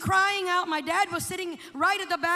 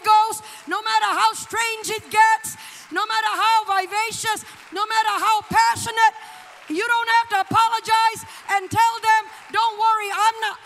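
loud, high, driving sermon delivery; full-force preaching; 5 semitones higher, 9 dB louder than usual. Real, not acted. Preacher urging congregation to stop apologizing, full-force preaching. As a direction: exhort the church with full voice.

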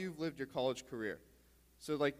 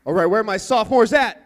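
loud call across the room; calling from far away; 4 semitones higher, 16 dB louder than usual. Real, not acted. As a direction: shout it out to the crowd. Calling out to the crowd.

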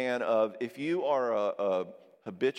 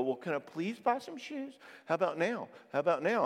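meek, higher-pitched begging; mock pleading; 5 semitones higher than usual. Spouse begging a jerk for shoes, mock pleading. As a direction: keep asking hopefully in a raised pitch.

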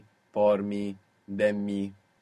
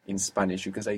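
normal talking voice

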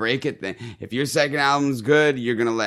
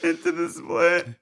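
laughing; 6 semitones higher than usual. Real, not acted. Finishing the joke through laughter.

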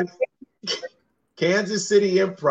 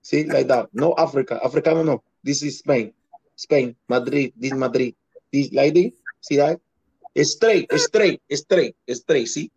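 Dominican accent impression. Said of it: "you sound just like one of them".

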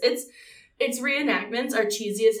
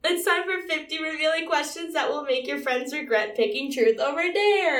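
segment announcer; 5 semitones higher than usual. Acted. Segment intro read.

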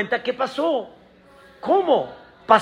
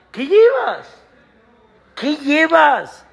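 curious question; 5 semitones higher, slower than usual. Retold or imitated. Asking the pilgrim.

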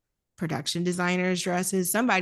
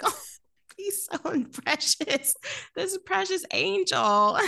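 high cooing over a sweetheart; high doting coo; 10 semitones higher than usual. Retold or imitated. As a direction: gush like he's a baby. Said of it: "And I was like".

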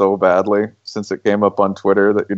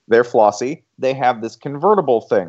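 usual speaking voice

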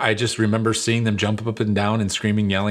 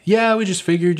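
high, louder mock parent; 11 semitones higher, 5 dB louder than usual. Parents telling their daughter why, high and bright. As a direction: play the cheerful parent. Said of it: "And they're like".

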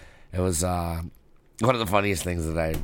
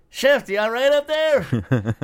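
high, loud, slow call up the mountain; 6 dB louder, slower than usual. Retold or imitated. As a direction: shout up to the tent. Calling up the mountain.

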